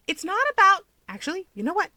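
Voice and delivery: bursting in frustration; exasperated Keigo. Acted. Keigo flustered at Toya, high exasperated burst.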